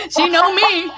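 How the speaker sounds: high, helpless laughter through the words; laughing recognition. Real, not acted. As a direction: crack up because you've been found out. Tickled by the frog reading.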